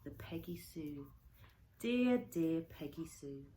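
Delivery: usual speaking voice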